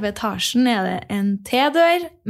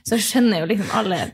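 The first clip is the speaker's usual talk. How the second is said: ordinary talk, nothing marked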